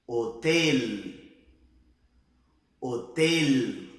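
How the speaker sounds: HOTEL loud, closed e and clear final l; closed vowel. Shown as a demonstration. Teacher giving a word to repeat, closed vowel. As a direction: model Spanish HOTEL, mouth narrow. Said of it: "Vamos repetir comigo?".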